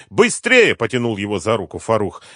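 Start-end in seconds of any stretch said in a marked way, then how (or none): 0.1-0.7 very high, loud hurrying cry; urgent shout. A narrator's reading. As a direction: shout to get him moving. Companion urges him to flee. (торопящий крик)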